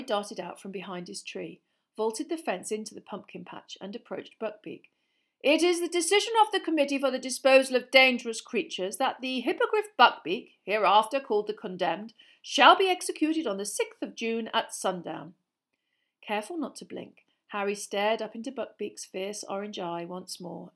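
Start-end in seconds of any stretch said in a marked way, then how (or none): 5.4-15.3 loud, formal proclamation of a sentence; pompous official reading a decree aloud; official reading verdict. A narrator's reading. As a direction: read out the death sentence like a stiff minister. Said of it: "As Fudge's voice started again".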